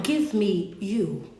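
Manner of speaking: slow emphatic plea. Acted. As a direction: let each word land slowly. Repeated plea, then slowed down.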